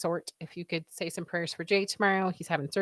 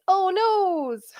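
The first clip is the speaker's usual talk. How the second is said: high, louder, squeaky "Oh, noes"; mock dismay; 13 semitones higher, 7 dB louder than usual. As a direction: ham up a cartoon gasp of dismay. Reading chat, playful dismay.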